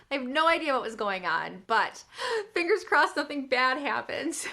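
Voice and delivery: normal talking voice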